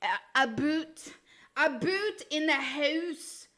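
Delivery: slow, stretched Canadian vowels; Canadian accent. Acted. Streamer practising "about", exaggerated Canadian accent. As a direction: draw out "boot" and "house" as a mock Canadian. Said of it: "when I try to do an accent".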